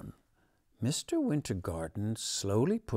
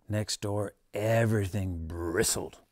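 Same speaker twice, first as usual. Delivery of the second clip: usual speaking voice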